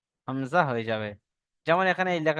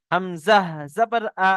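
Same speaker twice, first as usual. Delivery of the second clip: হামজা জবর আ spelled out slowly; slow recitation drill; 2 semitones higher, 4 dB louder, slower than usual. Shown as a demonstration. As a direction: model the spelling chant for students. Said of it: "আমি একটা দুটো পড়ে দেখিয়ে দিই".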